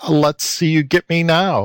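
plain reading voice

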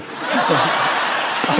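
a quick, breathy laugh at his own joke; soft chuckle after the punchline; short laugh. Real, not acted. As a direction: let a soft laugh slip out.

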